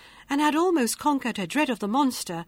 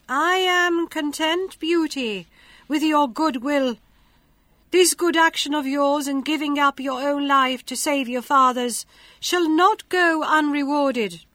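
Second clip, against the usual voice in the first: higher, louder, stately fairy voice; fairy lady; 5 semitones higher, 5 dB louder than usual. A narrator's reading. A fine lady in a dream.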